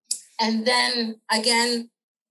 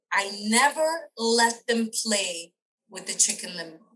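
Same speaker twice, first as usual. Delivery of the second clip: normal talking voice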